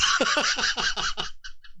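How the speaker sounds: evil chuckle, breathy and unvoiced; a gleeful evil snicker; villain laugh. Real, not acted. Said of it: "that's a villain laugh".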